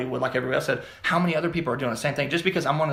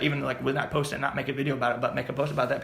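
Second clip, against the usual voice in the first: ordinary delivery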